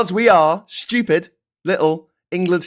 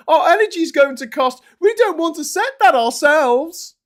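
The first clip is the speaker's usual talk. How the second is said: high, loud parody squawk; hammed-up shrill toadying; mock TV outrage; 10 semitones higher, 5 dB louder than usual. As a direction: plead in a silly high voice. Acted parody of politicians on TV.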